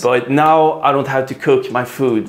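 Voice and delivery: usual speaking voice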